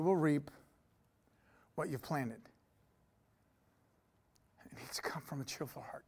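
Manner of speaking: soft, low, hushed sermon voice; quiet, low preacher voice with long pauses; intimate preaching. Acted. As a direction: pull the room close and speak softly.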